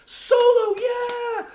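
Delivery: loud, very high whooping yell; full-voiced fan cheer; imitated crowd cheering. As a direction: holler like an amped-up movie audience. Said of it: "cheering at all the trailers".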